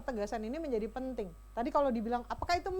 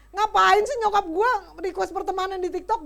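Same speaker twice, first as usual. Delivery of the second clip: louder, higher annoyed whine about mom; annoyed teen complaint; 4 semitones higher, 9 dB louder than usual. Retold or imitated. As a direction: gripe like a teenager whose mom added her. (keluhan remaja yang kesal, lebih keras)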